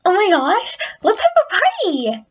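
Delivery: very high-pitched, excited squeal; way too high, bubbly party voice; Pinkie Pie. Acted. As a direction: squeak it like an over-excited cartoon pony. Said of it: "that sounded way too high-pitched".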